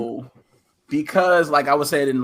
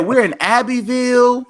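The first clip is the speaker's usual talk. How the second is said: high-pitched imitation of a chirpy relative; high mock-friendly quote; Anna's voice; 10 semitones higher than usual. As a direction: mimic her in a lifted, put-on voice. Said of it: "Bro, she said".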